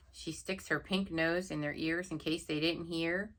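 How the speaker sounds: usual speaking voice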